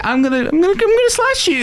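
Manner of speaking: high squeaky goofy voice; Martin Short impression. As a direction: squeal it like a silly kid. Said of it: "him being like".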